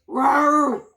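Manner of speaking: very loud, high mock dragon roar; dragon growl. Dragon roaring at the soldiers, very loud mock roar. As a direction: bellow it like a fierce little dragon. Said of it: "growled the Little Green Dragon".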